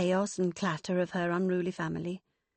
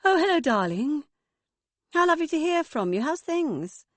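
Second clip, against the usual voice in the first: higher, louder sing-song "darling"; sing-song mother; 9 semitones higher, 6 dB louder than usual. Acted. Mother answering Megan's call, higher gushing sing-song. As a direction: gush over the phone like a doting mother. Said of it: "Lorelei's sing-song tones".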